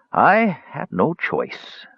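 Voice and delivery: put-on slow, pained hoarse old voice. A narrator's reading. Old man answers Klotnik, hoarse and exhausted. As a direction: push out words through exhaustion. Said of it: "voice carried the hoarseness of pain".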